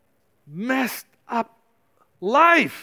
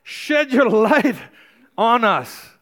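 raised, impassioned praying voice; preacher voicing the congregation's plea to God, fervent; fervent prayer; 6 semitones higher than usual. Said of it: "And I'm saying".

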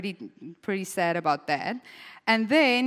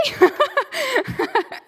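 high real laugh; 5 dB louder than usual. Real, not acted. Preacher laughing at Jacob's love story, high laughter.

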